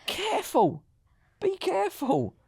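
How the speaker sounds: a teasing jealous warning; mock warning. Husband warning the flirting fundraiser, mock caution.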